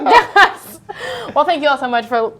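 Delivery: bright upbeat host sign-off; talk show host voice. Pretend. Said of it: "this is, like, my talk show host voice".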